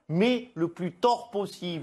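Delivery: slow. Acted. Slow punchline.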